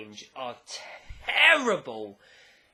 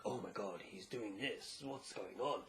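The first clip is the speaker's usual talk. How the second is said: much softer, lower mock worried murmur; hushed panic; 8 semitones lower, 14 dB quieter than usual. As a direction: mutter it like a panicked onlooker.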